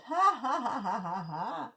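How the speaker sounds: rapid ha-ha running downward; hammed-up laugh, loud at first then fading; acted laughter. The boy laughs at the bee, mocking laughter. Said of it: "laughed and laughed".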